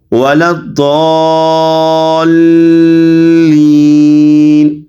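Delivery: ولا الضالین with a very long 'aa' after the heavy d, joined to a doubled l; stretched, then joined. Shown as a demonstration. Teacher demonstrates stretching then joining, ولا الضالین chanted slowly. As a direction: sustain the dad vowel in ولا الضالین, then land on lam. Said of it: "ضاد کو کھینچیں گے".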